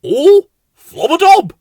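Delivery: high, loud exclamation of dismay; silly flowerpot-man cry; Bill. A narrator's reading. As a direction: squeak the nonsense word out. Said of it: "said Bill".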